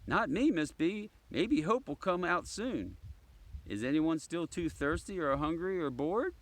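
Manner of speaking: put-on high, bright kid's voice; eager child. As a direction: answer like a keen pupil.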